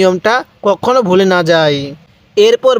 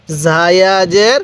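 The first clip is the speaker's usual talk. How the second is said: slow drill; much slower than usual. Shown as a demonstration. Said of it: "পড়ুন".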